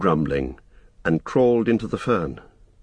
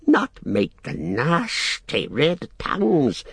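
slow, high creature hiss; 8 semitones higher, slower than usual. A narrator's reading. Gollum warns Sam against fire, hissing creature voice. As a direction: draw out the hissing sounds. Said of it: "hissed Gollum".

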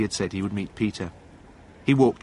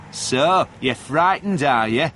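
higher-pitched jeer; taunting; 4 semitones higher, 4 dB louder, slower than usual. A narrator's reading. Gang boy mocks caught John, taunting. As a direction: needle him with a raised taunt.

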